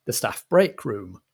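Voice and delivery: ordinary talk, nothing marked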